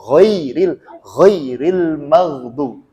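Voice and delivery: "Ghairil" with the glide bent at once; bent straight away. Shown as a demonstration. Teacher corrects students' glide, bent straight away. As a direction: chant "ghairil maghdu" slowly, turning the diphthong promptly. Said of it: "Harusnya langsung ditekuk".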